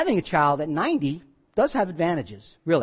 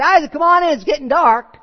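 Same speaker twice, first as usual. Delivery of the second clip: high, raised calling voice; 8 semitones higher, 5 dB louder than usual. Acted. Parents calling Isaac in to the table, calling out. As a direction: call a child indoors from a distance. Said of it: "when they call Isaac to the table".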